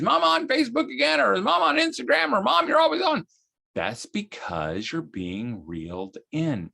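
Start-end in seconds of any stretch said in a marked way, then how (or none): 0.0-3.2 put-on high whiny child complaint; whiny kid. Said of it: "Moms, if your kid says".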